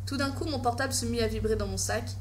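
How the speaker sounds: plain reading voice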